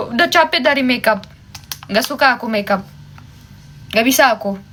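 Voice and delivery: normal talking voice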